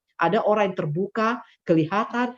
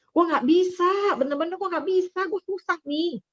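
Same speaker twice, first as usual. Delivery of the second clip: mock helpless whine; 11 semitones higher than usual. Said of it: "ya nggak bisa".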